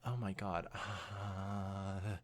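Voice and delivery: ordinary delivery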